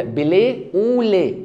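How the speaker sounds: "بلي او لي" very slow, syllable by syllable; French pronunciation. Shown as a demonstration. Teacher models the French dish name, slow French pronunciation. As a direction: draw out each French syllable of blé au lait. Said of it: "بتتنطق كده".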